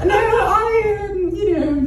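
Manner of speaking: very high, put-on British voice; straight-up British accent. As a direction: go full mock British, up high. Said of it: "straight up British now".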